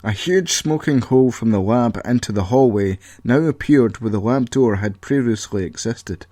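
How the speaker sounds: ordinary delivery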